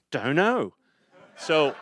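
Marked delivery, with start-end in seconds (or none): 0.1-0.7 lilting English drummer impression. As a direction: play a carefree madman rocker. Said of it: "He goes".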